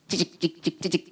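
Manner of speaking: very soft, low printing sound; imitated printer noise. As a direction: tick out a receipt quietly. (아주 작게 영수증 찍히는 소리 흉내)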